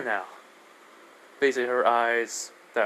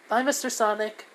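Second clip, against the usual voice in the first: put-on high little-girl voice; high-pitched kid farewell; little girl's goodbye; 10 semitones higher than usual. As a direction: say bye as a small child. Child says goodbye to Sonic.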